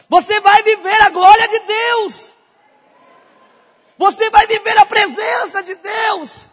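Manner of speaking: high, loud preacher's shout; full-force preaching. Real, not acted. Preacher promising the congregation God's glory, impassioned shouting. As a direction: proclaim it at the top of your range, drawing out the words.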